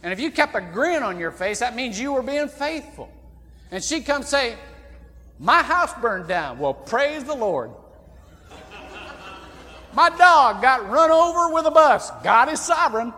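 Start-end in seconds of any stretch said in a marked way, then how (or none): 5.4-13.1 a hint of a forced cheery churchgoer, bad news then praise; lightly voiced lady, bright pasted-on cheer, the last lines louder; grinning lady. Preacher mocks a lady who grins through disaster, mock cheerful. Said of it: "never got the grin off her face".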